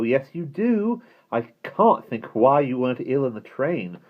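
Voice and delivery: put-on high, loud bickering child voice; squabbling child. A narrator's reading. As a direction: argue back like a child in a squabble.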